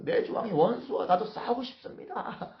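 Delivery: high-pitched, put-on fervent plea; brazen bluster. David posing as loyal to the Philistines, brazen bluster. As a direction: insist with exaggerated zeal. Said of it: "막 똥배짱을 부려가면서".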